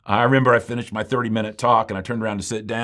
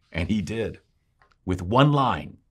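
ordinary delivery

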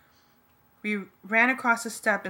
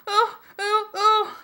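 very high, loud shrieks; scream; 14 semitones higher, 8 dB louder, much slower than usual. A narrator's reading. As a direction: shriek in short panicked bursts. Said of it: "Darlene screamed".

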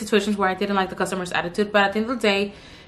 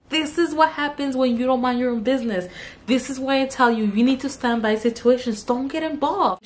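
ordinary talk, nothing marked

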